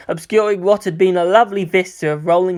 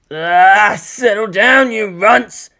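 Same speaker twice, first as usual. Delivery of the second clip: put-on loud, higher bark at the kids; shouting into a megaphone; 3 semitones higher, 4 dB louder, much slower than usual. A narrator's reading. Toji shouting at the rowdy kids through a megaphone. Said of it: "he shouted into the megaphone".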